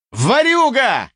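high, drawn-out accusing yell. A narrator's reading. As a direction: yell it high, stretching the word. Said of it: "заорал я". (заорать во всё горло)

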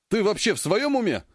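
high, indignant growl. A narrator's reading. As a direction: snap at him in disbelief. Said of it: "рыкнул на него Ержан".

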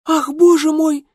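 high, loud alarmed exclamation; desperate cry. A narrator's reading. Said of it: "раздался отчаянный вопль".

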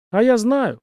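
a touch of grumpiness; mildly peevish grumble; grumbling. A narrator's reading. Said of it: "проворчал Дэн".

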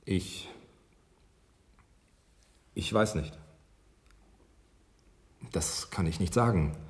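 quiet and low, long halting pauses; barely find the words; hesitant, shaken. Acted. Doctor faltering before the journalist, quiet and halting. Stunned by reporter's bleak questions.

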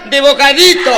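loud, high camp reply with laughter; camp guest. Acted. Naked guest answers the doorman, loud and high camp. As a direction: fling it out flamboyantly.

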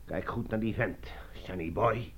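hushed whispered aside. Acted. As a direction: whisper so the man can't hear. Secret aside to boy. (fluisterend, zacht terzijde)

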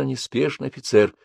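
plain reading voice

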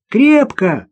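put-on high child's reply; girl's voice. A narrator's reading. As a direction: call back as a small girl. Girl calls back. (высокий детский отклик)

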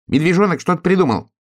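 high, excited hedgehog call; high-pitched little animal cry; hedgehog shouting. A narrator's reading. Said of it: "крикнул ёжик".

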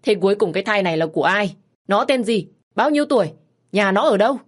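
loud, high barrage of questions; rapid-fire questioning. Acted. Mother grilling her daughter, loud rapid-fire questions. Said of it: "bà hỏi dồn".

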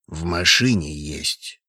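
slow, low, choked-off words; strangled voice. A narrator's reading. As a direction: squeeze it out through a tight throat. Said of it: "сдавленным голосом сказал Трошин". (сдавленным голосом)

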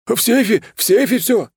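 high, loud, hurried confession; panicked yell. A narrator's reading. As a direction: blurt it out at the top of the voice. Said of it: "заорал тот". (заорать в панике, высоко)

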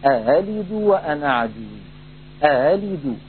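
أألد with the second hamza softened, neither full nor h; eased open hamza. Shown as a demonstration. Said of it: "هكذا نطق الهمزة المسهلة المفتوحة".

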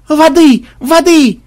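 very high, loud cries; frantic repeated yell; shouting. A narrator's reading. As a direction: call out urgently for help. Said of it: "кричал кто-то".

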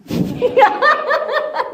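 high, loud peal of laughter; burst of laughter. Real, not acted. Teacher breaks up at the class's answer, laughing out loud. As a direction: crack up helplessly. Teacher cracks up at own question.

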